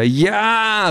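high, drawn-out cheer; elated call; cheering shout. A narrator's reading. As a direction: cheer them on at a high pitch. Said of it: "ruft Peter".

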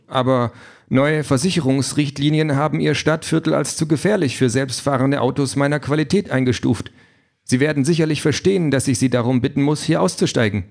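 the car apologetically throws him out, all politeness; overly courteous AI car, regretful; polite car voice. Acted.

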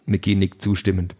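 usual speaking voice

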